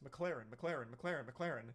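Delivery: "McLaren" rattled off quickly on repeat; rapid-fire "McLaren", casually repeated; saying it fast. Shown as a demonstration. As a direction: run each "McLaren" straight into the next. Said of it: "just saying it fast".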